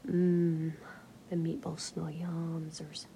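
hushed, breathy-free whisper, close to the mic; quiet whispered talk; soft whisper. Acted. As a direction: whisper it like ASMR chat. Quiet whispered chat about food.

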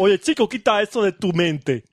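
a hint of a Cuban drawl, teasing; Cuban accent. Teasing a co-host in a mock Cuban accent. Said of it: "te lo digo en acento cubano".

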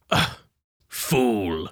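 a hint of a low villain; evil voice. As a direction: growl out the word like a villain. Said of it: "Do the voice again".

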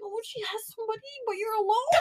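small, very high, soft voice; imitated worried mom. Mom worrying daughter is alone, imitated high fretting. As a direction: fret like a mom, high and hushed. Voicing mom's sad concern.

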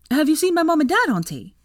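put-on high, loud child's voice; young boy. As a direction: ask eagerly like a little boy. Young nephew asks his aunt.

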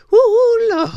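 high, loud whooping cry; excited whoop. Acted. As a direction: whoop it up like church is on fire. Hyping the sweating fans.

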